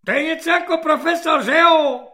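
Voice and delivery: high, loud, rapid screech; ječivý svobodník. A narrator's reading. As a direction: pounce on it as a delighted, screechy drill corporal. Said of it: "ječivým hlasem svobodníka". (ječivě, rychle a nahlas)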